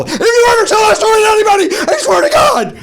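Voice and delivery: very high, loud threatening yell; Shelley yells a threat not to tell the story; furious shout. Retold or imitated. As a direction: scream a threat at him. Shelley threatens, goes nuts.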